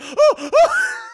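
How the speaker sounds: high, loud helpless "oh, oh"; mock shaken-victim cries. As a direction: cry out as if shaken, hammed up. Cyclist being shaken.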